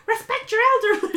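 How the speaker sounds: high, loud imitated scolding; scolding shout. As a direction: yell at him as Kelly, mocking. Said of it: "Kelly chimes up".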